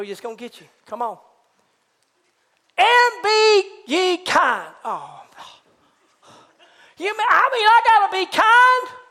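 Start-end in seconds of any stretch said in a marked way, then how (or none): 2.8-4.7 high, loud drawn-out scripture proclamation; loud verse reading. Acted. Preacher reads the command to the church, loud and drawn out. 7.0-9.0 high, loud disbelieving question; mock incredulous. Balking churchgoer asks about being kind, loud mock disbelief.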